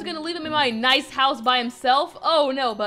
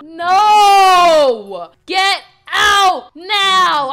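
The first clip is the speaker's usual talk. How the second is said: loud, drawn-out order to get out; slow, emphatic angry yell; furious shout; 10 dB louder, much slower than usual. Acted. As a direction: throw them out at full volume. Acted breakup, throwing someone out.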